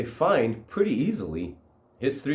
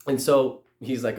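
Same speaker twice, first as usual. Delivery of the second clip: usual speaking voice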